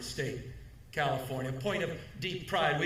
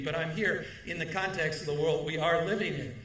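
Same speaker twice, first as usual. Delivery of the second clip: ordinary talk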